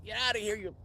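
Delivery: high-pitched yell without full volume; retold scream. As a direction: mock-scream it to scare off a bear. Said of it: "started screaming".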